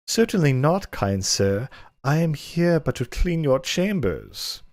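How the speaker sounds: hammy, high coy servant voice; coy maid voice. Acted. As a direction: play the bashful Argonian maid, hammed up. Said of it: "inappropriate voice I can muster".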